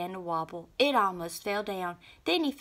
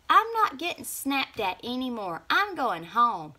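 in a huff; 6 semitones higher, 5 dB louder than usual. A narrator's reading. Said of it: "in a huff".

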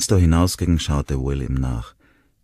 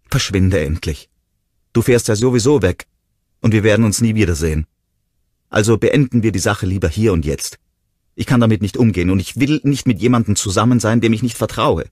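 louder and higher, sending him away; angry shout; 7 semitones higher, 7 dB louder than usual. A narrator's reading. Ronnie lo echa.

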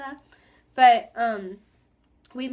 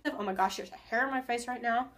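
usual speaking voice

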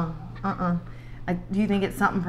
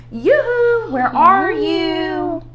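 high sing-song yoo-hoo, louder; calling out; 12 semitones higher, 5 dB louder, much slower than usual. A narrator's reading. God calls out to hiding Adam and Eve, sing-song. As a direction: call out playfully across the garden. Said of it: "God called out to them".

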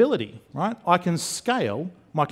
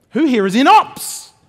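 loud, high cheer rising on "ops"; rally your own team with a shout; big hyped-up shout-out to the crowd; rousing crowd call; 9 semitones higher, 10 dB louder than usual. Pretend.

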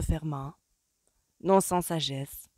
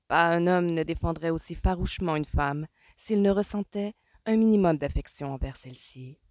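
usual speaking voice

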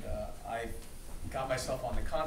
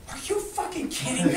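much higher, laughing outraged cry; Donna's outraged disbelief; 13 semitones higher than usual. Retold or imitated. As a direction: mimic her shocked, disgusted outburst. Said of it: "looks around, and goes".

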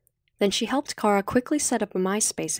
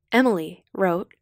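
ordinary delivery

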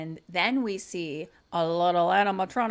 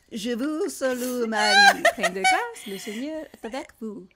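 hammed-up French, jumping higher and louder on Marie; mock French prayer; 4 semitones higher, 4 dB louder than usual. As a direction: recite a French prayer in an overdone voice. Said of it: "I can only do Italian".

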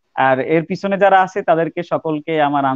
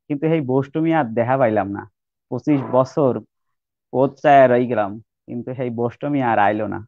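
slow, measured recitation in Barishal Bengali; Barishal dialect poem recitation. Acted. Guest radio শ্রোতাদের জন্য বরিশালের ভাষায় ধীরে কবিতা আবৃত্তি করছেন. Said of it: "সেই কবিতাটা আমি বরিশালের ভাষায় বলতে চাচ্ছিলাম".

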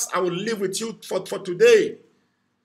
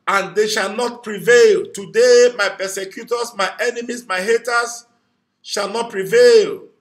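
fervent loud declaration; 6 dB louder than usual. Real, not acted. Preacher leading viewers to declare victory loudly. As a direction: proclaim it like a battle cry. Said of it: "Declare it loud".

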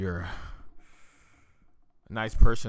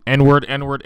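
loud chanted provocation; half-serious yell; mock yelling; 2 semitones higher, 10 dB louder than usual. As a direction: blurt it out loudly to provoke. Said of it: "just yell out m-, mean things".

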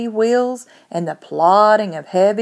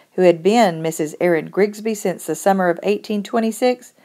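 usual speaking voice

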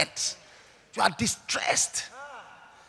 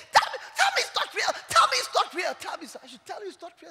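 terrified plea; 12 semitones higher, faster than usual. Retold or imitated. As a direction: beg in terror at death's door.

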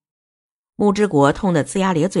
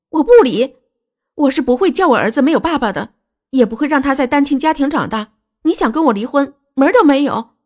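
high-pitched, vehement insistence; defiant refusal; 7 semitones higher, 3 dB louder than usual. Acted. Wife refusing husband's divorce for their son's sake, defiant. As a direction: refuse outright, loud at the start and end.

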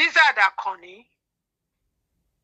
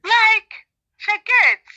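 loud exasperated outburst; 10 semitones higher, 7 dB louder, much slower than usual. Real, not acted.

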